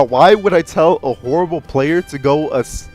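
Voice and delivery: normal talking voice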